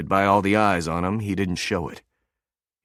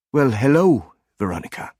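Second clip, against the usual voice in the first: put-on soft German accent; 5 semitones higher than usual. A narrator's reading. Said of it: "The German accent was soft".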